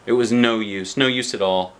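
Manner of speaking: usual speaking voice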